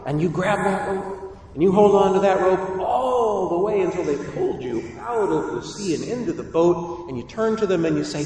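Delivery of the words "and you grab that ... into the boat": ordinary delivery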